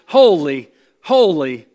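each "holy" stressed loud with a falling tune; emphatic repetition. Shown as a demonstration. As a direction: punch "holy" and leave space after it. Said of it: "we see this three times emphasized".

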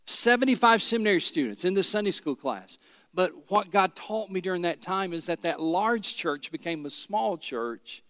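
normal talking voice

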